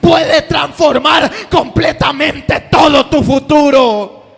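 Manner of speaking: loud, high, driving sermon shouting; preaching at full force. Acted. Preacher calling the congregation to answer God, shouting. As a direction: preach it hard to the whole congregation.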